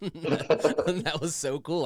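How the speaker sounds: laughing through it, rising into the laugh; real laughter, slowing down at the end. Real, not acted. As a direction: laugh it out, delighted. Laughing at getting punched.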